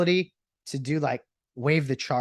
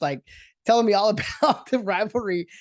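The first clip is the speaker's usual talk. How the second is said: normal talking voice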